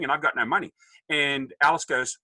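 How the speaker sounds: ordinary talk, nothing marked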